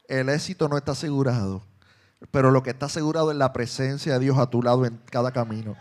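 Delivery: loud, rising sermon climax; full-force preaching. Acted. Pastor proclaiming God's presence to his flock, loud building preaching. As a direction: build it up and shout the promise to the hall.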